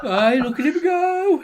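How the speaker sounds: high, loud laughing cheer. Real, not acted. Laughing along at the video, cheering the naked man. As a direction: cheer him on through laughter.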